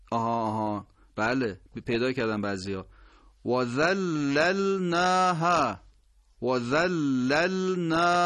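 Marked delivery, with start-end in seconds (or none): 3.5-8.2 ذللناها slow, each lam distinct; slow precise recitation; careful lams. Shown as a demonstration. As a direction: pronounce every lam in ذللناها clearly. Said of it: "سه تا لام".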